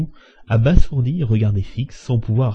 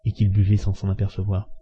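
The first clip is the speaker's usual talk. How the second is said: ordinary delivery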